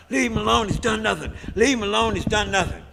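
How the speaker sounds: high, loud hollered plea; Huey hollering at the police to leave him alone, loud and high. Retold or imitated. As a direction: yell it at the cops to back off. Crowd chanting in defense.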